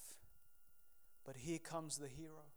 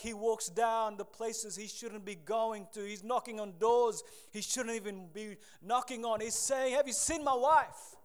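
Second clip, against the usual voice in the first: rising louder, higher desperate search; building preaching; 4 semitones higher, 9 dB louder than usual. Acted. Preacher building up Hosea's frantic search for his wife.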